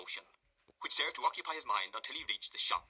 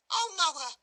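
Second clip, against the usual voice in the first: much higher, louder reproach; reproachful cry; 8 dB louder than usual. A narrator's reading. Charlotte reproaching Noah for blaming her. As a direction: cry out, wounded. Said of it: "cried Charlotte reproachfully".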